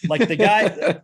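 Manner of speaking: laughing through the words, high and loud. Real, not acted. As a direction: break into laughter mid-sentence. Laughs at the disorder joke.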